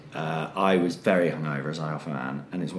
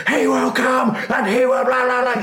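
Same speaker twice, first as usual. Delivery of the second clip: much higher, louder shrieked prophecy; imitating screaming; 5 dB louder than usual. Nell after the dunking, screaming at the audience, imitated. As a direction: shriek it like a possessed witch. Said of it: "just starts screaming this stuff".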